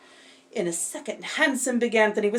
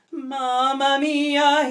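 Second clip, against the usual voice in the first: slow, louder mamma mia; cried out; 5 semitones higher, 6 dB louder, much slower than usual. Acted. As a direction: drag it out in dismay. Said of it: "he cried".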